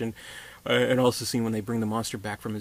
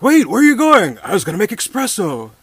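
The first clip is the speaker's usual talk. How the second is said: much higher, louder mock call; calling after him; 10 semitones higher, 12 dB louder than usual. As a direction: yell after someone who's leaving. Blind man calling after monster.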